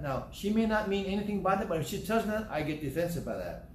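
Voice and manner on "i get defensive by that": ordinary talk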